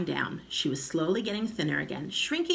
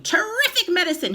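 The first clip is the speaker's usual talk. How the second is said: high loud screechy cry; old grandma crying out; 13 semitones higher, 8 dB louder than usual. A narrator's reading. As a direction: shout it out gleefully. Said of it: "she cried".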